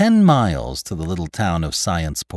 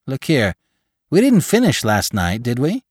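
higher-pitched questioning; puzzled gravedigger; 6 semitones higher, 4 dB louder than usual. A narrator's reading. Laborer checking with Jim about last night's work, puzzled. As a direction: check with him, voice lifted.

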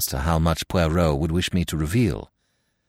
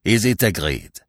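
urging, more restrained question; Poirot. A narrator's reading. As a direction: press gently for agreement with a falling end. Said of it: "urged Poirot".

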